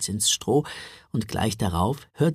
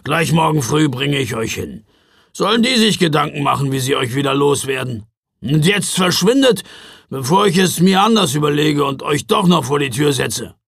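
loud gruff orders; gruff Ruprecht; 7 dB louder than usual. A narrator's reading. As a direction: shoo them off grumpily. (mürrischer Ruprecht, laut befehlend)

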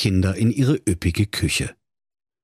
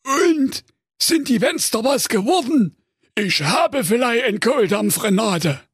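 high, impatient ogre voice; a hungry ogre nagging his wife; 14 semitones higher than usual. Acted. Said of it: "fragte ungeduldig".

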